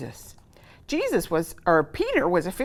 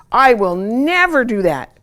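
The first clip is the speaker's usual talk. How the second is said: protest; 6 semitones higher, 11 dB louder than usual. Acted. Peter protests to Jesus.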